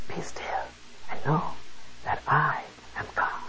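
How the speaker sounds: hushed whisper, breath only. Shown as a demonstration. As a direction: whisper the verse as if to calm a crowd. Verse repeated hushed, second time.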